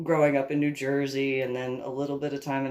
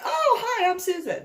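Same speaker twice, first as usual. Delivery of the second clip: much higher, bright nervous "Oh, hi, I'm Susan"; chirpy greeting; 5 dB louder than usual. Retold or imitated. Introducing herself to the guitar player, high and nervous. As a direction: squeak out your name like an awkward guest. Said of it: "I was like".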